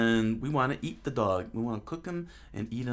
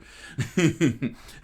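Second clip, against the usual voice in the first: loud, quick run of chuckles; real giggle; 4 semitones higher, 7 dB louder than usual. Real, not acted. Host runs out of voices, giggles. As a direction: giggle at your own predicament.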